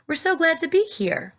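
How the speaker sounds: high, cheerful call; happy call. A narrator's reading. James calling down to the firemen. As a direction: reassure them brightly.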